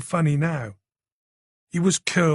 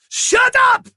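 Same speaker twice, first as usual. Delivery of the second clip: high, loud panicked scream; gunman's frantic scream at the crowd; 8 dB louder than usual. A narrator's reading. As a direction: scream the room into silence. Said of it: "he screamed".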